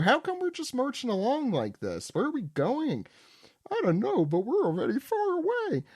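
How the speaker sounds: put-on high, whiny lost children; high kid voices. Recapping manga to friends, voicing scared children high. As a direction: play the confused kids, high.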